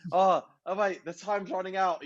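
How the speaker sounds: high, urgent mock panic; retold night terror. DJ sleep-talking after a gig, panicky. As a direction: fret aloud as if caught mid-set. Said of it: "having a bit of a night terror".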